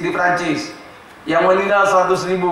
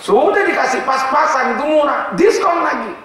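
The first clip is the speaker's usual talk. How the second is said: indignant outcry; 7 semitones higher than usual. Retold or imitated. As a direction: rise in pitch and voice, appalled at the cheek. Indignant rebuke, voice shoots up.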